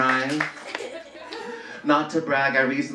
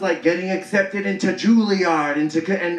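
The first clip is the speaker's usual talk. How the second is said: normal talking voice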